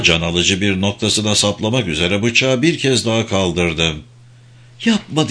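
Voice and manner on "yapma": Maud's sharp cry of stop; shout. A narrator's reading. Maud to Humphrey, stopping the knife: sharp cry. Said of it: "diye bağırdı o".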